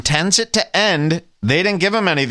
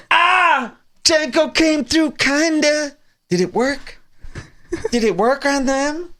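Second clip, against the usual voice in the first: very high, put-on excited voice; mock excited relief; 10 semitones higher than usual. Commentator mimicking the anxious friends, mock excited. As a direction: play the over-eager onlooker, high and hopeful. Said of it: "he's more excited that it sounds like he came through".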